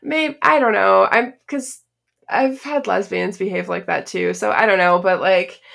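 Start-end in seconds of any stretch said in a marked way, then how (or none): none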